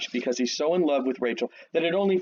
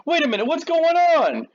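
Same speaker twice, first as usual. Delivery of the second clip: much higher, louder startled cry; shocked outburst; 6 dB louder than usual. Retold or imitated. Jacob waking beside the wrong bride, in a high shocked outburst. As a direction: catch the switch all at once and blurt it out.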